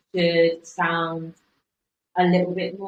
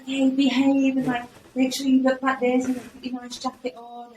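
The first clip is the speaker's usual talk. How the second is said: higher, mocking fussy manager; prim office boss; 6 semitones higher than usual. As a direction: parody a prim boss giving orders. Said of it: "when they, an important guest is coming".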